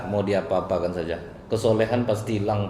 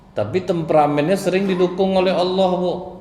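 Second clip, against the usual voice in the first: emphatic preaching; 10 semitones higher, 6 dB louder than usual. A narrator's reading.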